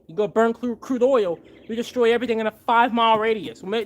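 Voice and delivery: ordinary talk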